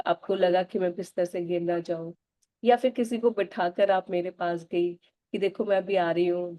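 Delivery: usual speaking voice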